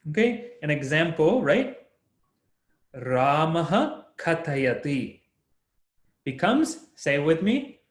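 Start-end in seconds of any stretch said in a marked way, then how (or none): 3.0-5.2 slow "Ramaha katayati" with a clear ha; emphasized visarga. Shown as a demonstration. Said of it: "I'm emphasizing it a little bit more".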